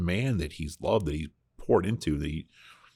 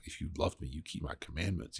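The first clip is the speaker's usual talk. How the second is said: scolding; 11 dB quieter than usual. Retold or imitated. Jesus scolding a disciple who just sinned, quiet reproach. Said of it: "scoldingly be like".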